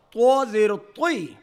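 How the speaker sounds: heavy thick ط sound; full-mouthed heavy ط. Shown as a demonstration. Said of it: "منہ بھر کر موٹی آواز".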